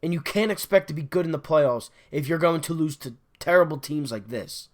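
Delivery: ordinary talk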